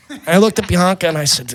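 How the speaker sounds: ordinary talk, nothing marked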